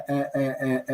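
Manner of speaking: ordinary talk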